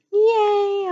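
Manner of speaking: put-on loud, high children's cheer; cheering yay. A narrator's reading. Children cheer when the teacher offers story time, shouting. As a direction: shout it out with glee. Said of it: "cried, called all the children".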